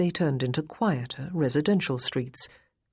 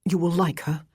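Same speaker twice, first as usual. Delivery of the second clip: a touch of anxiety, held in; mildly anxious, hoping for approval; understated worry in a gentleman's line; almost anxious. A narrator's reading. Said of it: "He sounded almost anxious".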